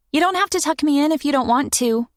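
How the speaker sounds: put-on high, quick child's voice; a kid pretending not to care; young girl voice. Child voice in story.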